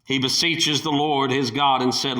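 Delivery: usual speaking voice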